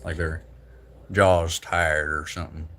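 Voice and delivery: slack-jawed, slurred Texas drawl; slow Texan speech through a loose jaw; Texas accent. Shown as a demonstration. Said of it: "like, a slack jaw".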